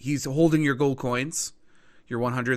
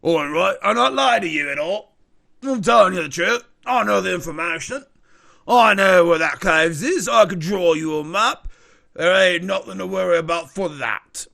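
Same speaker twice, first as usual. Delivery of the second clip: louder, insistent dealer's voice; defensive informant; 5 semitones higher, 9 dB louder than usual. Acted. Informant swearing he tells the truth, insistent. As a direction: protest your honesty like a shady dealer.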